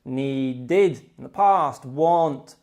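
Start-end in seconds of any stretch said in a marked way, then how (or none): 0.0-1.0 slow "needed", nee-DID; teacher's model of "needed" ending in id. Shown as a demonstration. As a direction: split "needed" into two clear beats. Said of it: "The id sound is put on".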